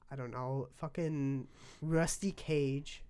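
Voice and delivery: ordinary delivery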